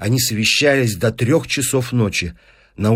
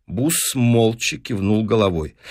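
usual speaking voice

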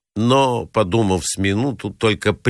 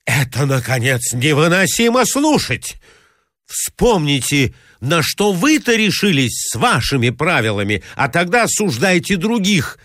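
higher, indignant retort; exasperated protest; 6 semitones higher than usual. Acted. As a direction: turn her accusation back. (высокий, возмущённый ответ)

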